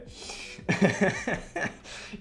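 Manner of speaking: real, breathy burst of laughter; amused laugh. Real, not acted. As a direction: crack up at your own joke. Amused at own drawing.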